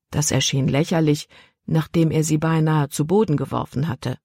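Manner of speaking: ordinary delivery, nothing marked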